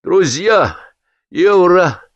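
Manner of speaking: put-on high, slow, drunkenly forced start; with visible effort. A narrator's reading. Drunk host forcing out a toast, labored. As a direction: push each word out with effort. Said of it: "начал он с видимым усилием".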